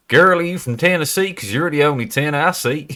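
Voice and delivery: high country American Tennessee twang; Tennessee accent. Shown as a demonstration. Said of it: "Tennessee specifically".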